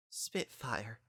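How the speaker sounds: very soft, quivering. A narrator's reading. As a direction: let the voice tremble. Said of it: "couldn't keep her voice from quivering".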